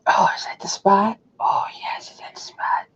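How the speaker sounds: soft whisper to a pet. Real, not acted. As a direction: whisper it gently. Whispering to the dog.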